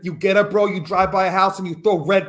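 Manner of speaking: imitated roommate in a Long Island accent; Long Island bro accent. Imitating college roommate Steve's breakup advice, in a Long Island accent. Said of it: "Long Island talked like that".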